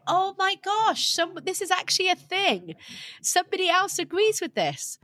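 high-pitched amazed realisation; delighted discovery, opening words stretched out. Retold or imitated. Listener finding someone finally agrees with her, amazed. Retelling thrilled discovery of the podcast.

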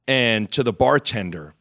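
normal talking voice

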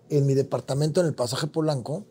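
ordinary talk, nothing marked

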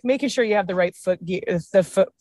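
ordinary talk, nothing marked

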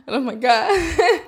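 high, slow surprised gasp; mock surprise. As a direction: drawl it out in fake shock. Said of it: "You're like".

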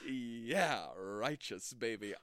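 a hint of groovy spy swagger; lightly voiced Austin Powers. Playing Austin Powers for his friend, lightly voiced. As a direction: lean into baby, easy and smug. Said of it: "got a little Austin Powers to it".

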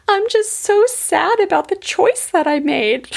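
put-on high-pitched, sorrowful small-child voice; imitated sad little boy. Little boy confesses to dad about his choice, acted sadness. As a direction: play a regretful little kid in a high voice. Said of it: "And his little boy said".

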